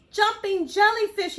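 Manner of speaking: high, loud squeal of delight. Acted. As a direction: squeal it with joy. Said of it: "he squealed".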